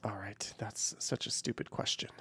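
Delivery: very soft, near-whispered grumble; muttered aside. Real, not acted. Streamer to himself over a chat question, muttered. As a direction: mutter it under your breath.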